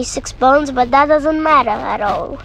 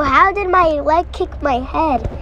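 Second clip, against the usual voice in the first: ordinary talk, nothing marked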